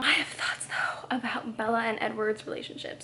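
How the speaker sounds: soft, unvoiced whisper. Real, not acted. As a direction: confide it in a whisper. Confiding aside to viewers.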